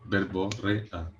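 verborrea very slowly, syllable by syllable; sounding it out. Shown as a demonstration. Friend testing the disputed word, sounding verborrea out slowly. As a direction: stretch out ver-bo-rre-a, quietly.

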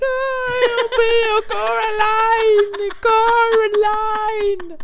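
high, loud, slow wailing cry; hammed-up laugh-crying lament; mock sobbing. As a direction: whimper the name as if haunted by it. Laugh-crying at four in the morning.

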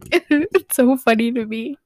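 ordinary talk

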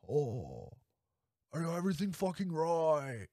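retry a clumsy star impression, rising into a rant; bad Nicolas Cage. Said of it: "Nope, this is embarrassing".

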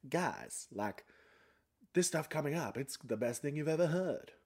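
lightly voiced podcast hype man; hyped podcaster. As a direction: oversell it like a host teasing guests. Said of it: "like, podcast thing to do".

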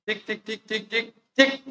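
soft, quick "tic" clicks; little ticking sounds, evenly repeated; imitated tapping noise. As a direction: imitate tapping on a phone app. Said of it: "y luego le hago".

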